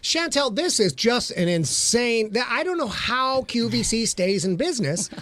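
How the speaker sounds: lilting home-shopping presenter patter; hammed-up shopping channel pitch; QVC host. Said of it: "He wants to break into Q V C".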